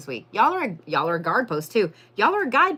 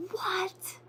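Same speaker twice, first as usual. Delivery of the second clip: breathless high whispered what; stunned whisper; 12 semitones higher, 11 dB quieter than usual. Real, not acted. Reader shocked at the like count, whispered. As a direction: gasp out a whispered what in disbelief. Reacting to million likes.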